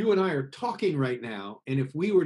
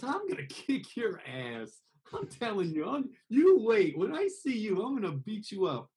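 sweet soft threat; 6 semitones higher than usual. Shown as a demonstration. Threatening someone in a soft sweet voice. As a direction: threaten like you're about to hug them. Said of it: "sounds like they're really gonna hug you".

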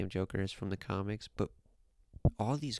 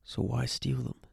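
ordinary talk

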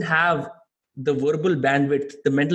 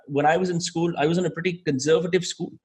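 ordinary talk, nothing marked

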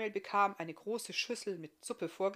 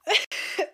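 sharp higher cough; 13 semitones higher, 13 dB louder than usual. Acted. Iska coughing before she goes on, short high cough. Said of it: "Iska hustete wieder".

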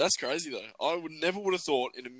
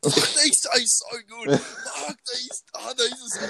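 put-on high, slow delighted drunk gushing; mock drunk raving; 9 semitones higher, slower than usual. Mate imitates drunk Adam loving the drink, gushing. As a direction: gush over the drink like a drunk. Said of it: "and he's like".

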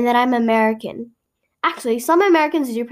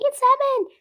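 mock yelling; 12 semitones higher than usual. Opponents yelling at the speaker, high mock yell. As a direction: squeal it like an indignant objector. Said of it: "yelling me".

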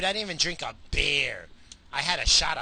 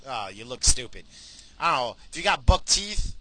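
ordinary talk

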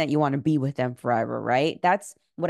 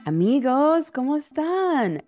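excited greeting; 10 semitones higher, 4 dB louder than usual. Real, not acted. Host greets listeners excitedly.

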